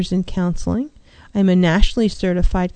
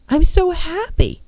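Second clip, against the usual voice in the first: higher, lively burst of happiness; energetic happy; 8 semitones higher than usual. Shown as a demonstration. Counselor showing listeners that feelings carry energy, bright and excited. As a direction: put real energy behind it. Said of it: "There's energy behind those words".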